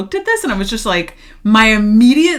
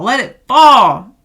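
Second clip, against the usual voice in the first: "let it fall" louder and slower, pitch lifted; loud declaration; 4 semitones higher, 8 dB louder than usual. Acted. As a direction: proclaim it slowly and loud. Tarot reading, urging to let go.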